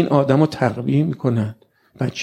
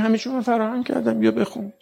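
God inviting; 6 semitones higher than usual. Acted. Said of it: "میگه".

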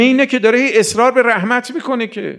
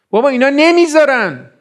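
raised, higher, louder exclamation; indignant outcry; 7 semitones higher, 4 dB louder than usual. Real, not acted. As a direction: call it out in protest. Preacher exclaims at obstructers.

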